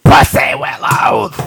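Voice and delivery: rough, whispery smoker's rasp. Shown as a demonstration. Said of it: "I said it in Smoker's voice".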